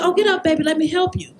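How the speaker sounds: put-on high little girl voice, kind. Mother retelling daughter comforting a fallen boy, high child voice. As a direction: voice your five-year-old daughter helping.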